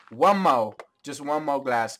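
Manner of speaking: loud smooth pimp swagger; smooth flashy pimp swagger. Acted. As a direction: strut the words like a pimp. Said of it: "I'm even talking in this pimp voice".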